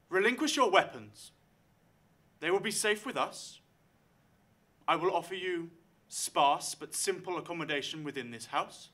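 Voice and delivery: proud matriarch laying down terms; commanding lady of the house. Acted. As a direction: noble host addressing armed strangers, loud and grave.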